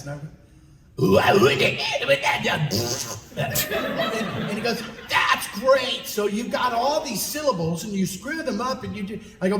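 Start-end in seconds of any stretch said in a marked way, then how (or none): 1.0-2.7 loud, garbled cartoon beast; Taz. Shown as a demonstration. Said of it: "give me some Taz". 5.1-9.2 high, thrilled outburst; Mark Hamill. Retold or imitated. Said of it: "That's my Mark Hamill, by the way".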